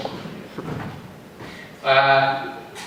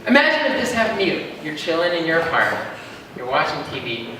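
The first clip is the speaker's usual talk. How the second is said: usual speaking voice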